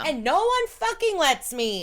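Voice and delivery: put-on loud, high kid's complaint; high, loud protest at the unfairness; indignant child. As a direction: wail it like a thwarted kid. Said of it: "especially when you're a child, you're like".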